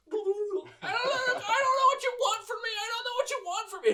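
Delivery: very high, whiny squeak near tears; whimpering little creature. Acted. Sklunk dangling by his wing, high whimpering squeal. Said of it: "His little fat body is like".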